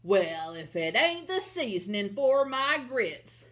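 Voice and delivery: loud, gloating storybook giant; big giant voice, pleased at spotting dinner; shouting giant. Acted. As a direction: call it out like a menacing giant. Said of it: "shouted the giant".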